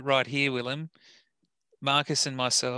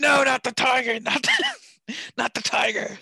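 mock yell; 10 semitones higher, 5 dB louder than usual. Co-host joins the mock yell.

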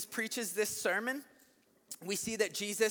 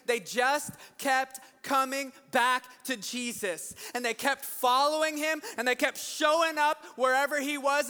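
raised, emphatic preaching voice; impassioned preaching; 4 semitones higher than usual. Real, not acted. Preacher urging congregation to keep coming back, impassioned preaching. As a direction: drive each phrase home to rouse the congregation. Preacher builds to rousing exhortation.

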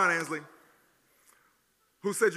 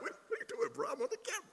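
much higher, thin mock voice; confused kid; faster than usual. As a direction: squeak it like a baffled kid on camera. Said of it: "He's like".

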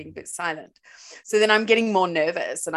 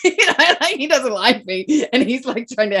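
laughing; 6 semitones higher, 10 dB louder than usual. Real, not acted. Laughing retelling date.

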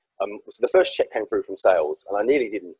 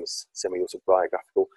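ordinary talk, nothing marked

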